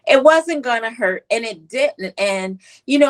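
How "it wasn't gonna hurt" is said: ordinary delivery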